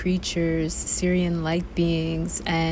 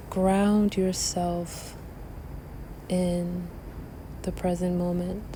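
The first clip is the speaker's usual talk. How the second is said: guided meditation; 4 dB quieter than usual. Acted. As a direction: guide the breath calmly. Leads a breathing meditation.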